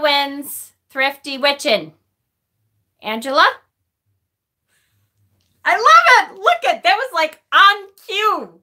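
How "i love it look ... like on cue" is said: high, loud burst of delight; delighted outburst. Real, not acted. Streamer thrilled that a link appeared on cue, delighted. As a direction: exclaim with glee, stretching the last words.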